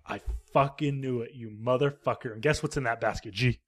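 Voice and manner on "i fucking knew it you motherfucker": low menacing. Retold or imitated. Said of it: "Christopher Lee's like".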